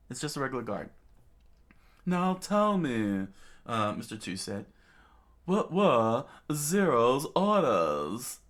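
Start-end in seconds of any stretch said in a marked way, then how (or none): 2.1-3.3 high, slow, drawn-out demand; theatrical interrogator. A narrator's reading. Mister Two questioning a pinned guard, theatrical. 5.5-8.4 high, loud, slow demand; theatrical interrogator. A narrator's reading. Mister Two demanding orders from a guard, theatrical.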